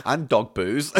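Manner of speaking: ordinary talk, nothing marked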